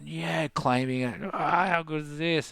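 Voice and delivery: high, thrilled whoop of joy; stoked cheer. Retold or imitated. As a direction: relive the rush, pitch up and cheer. Buzzing among whales, claiming it.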